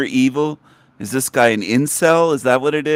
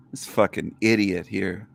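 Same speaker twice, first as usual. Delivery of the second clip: ordinary talk, nothing marked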